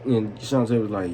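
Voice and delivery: ordinary talk, nothing marked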